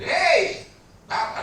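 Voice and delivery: high, loud shout; Batak calling out. Retold or imitated. Preacher imitates a Batak man calling someone, loud shout. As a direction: call out hard like a Batak man. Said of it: "Atau Batak panggil".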